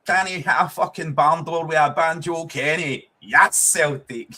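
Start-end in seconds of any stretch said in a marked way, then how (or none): 3.2-4.3 louder, drawn-out cheer; slow celebration. Real, not acted. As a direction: stretch the yes out in triumph. Celebrating the Celtic goal.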